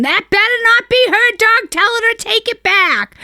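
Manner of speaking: high, loud imitated yelling; mock yell. Husband yelling from the background at wife, high loud mock yell. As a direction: bark it from the next room. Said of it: "my husband in the background yelling".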